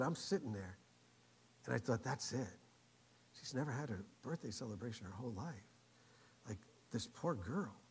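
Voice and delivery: low, hushed, sympathetic musing; sad reflection. Retold or imitated. Preacher retelling a man's pity for the girl, sad. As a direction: let it sink quietly, a touch of pity.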